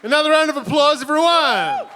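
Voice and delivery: rally the crowd for applause; hyped applause call. Real, not acted. Host rallying the audience after an act, hyped call.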